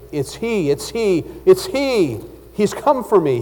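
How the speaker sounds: dramatized fearful cry; mock terrified groan. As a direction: voice the panicked man, urgency rising with each repeat. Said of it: "he groaned".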